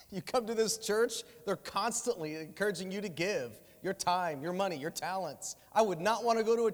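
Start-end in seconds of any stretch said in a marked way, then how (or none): none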